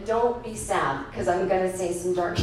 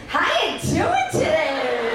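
drawn-out, higher-pitched mock cheer; chirpy clerk; 5 semitones higher, 3 dB louder, slower than usual. Rental guy greeting a miserable customer, overly chirpy. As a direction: play an oblivious, over-cheerful clerk.

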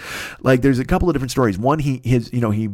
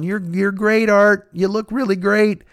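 high-pitched fawning reassurance; mock coddling praise; 7 semitones higher, slower than usual. People swaddling Artie and sitting him down, mock coddling praise. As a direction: imitate someone babying a friend, pitched up.

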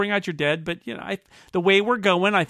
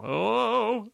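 higher, drawn-out "oh"; a long mock-alarmed oh; 8 semitones higher than usual. Mock alarm as robots cause trouble.

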